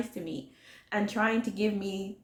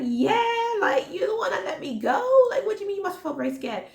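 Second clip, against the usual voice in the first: incredulous retort; 11 semitones higher, 3 dB louder than usual. Retold or imitated. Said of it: "And I was like".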